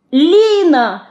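put-on high, loud desperate child's cry; little girl crying out in despair. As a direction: call out in despair to her. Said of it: "в отчаянии крикнула мышка".

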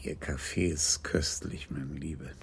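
whispered, soft and low. Acted. Kommissaris thanking Antoinette for her coffee, whispered softly. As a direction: breathe the compliment under your voice.

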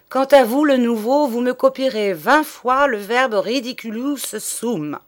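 loud, high stern order; angry teacher punishing the new boy; angry punishment. Acted.

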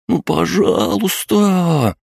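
put-on very high, drawn-out childish whine; plaintive pleading. A narrator's reading. Children plead with the sergeant, plaintive high whine. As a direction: beg like a child, stretching the words high. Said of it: "Их жалобные просьбы".

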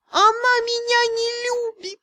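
high, wailing mock tragedy; tragic stage whisper. A narrator's reading. Cat complaining to heroine, tragic stage whisper. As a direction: ham up a heartbroken cat. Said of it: "Трагическим и громким шёпотом".

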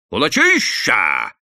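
громко, медленно, с нажимом; выкрикни обвинение; крик. Acted. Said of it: "закричал на него колхозник". (колхозник кричит на мужика обвинение)

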